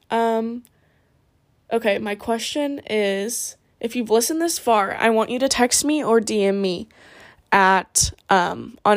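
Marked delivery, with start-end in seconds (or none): none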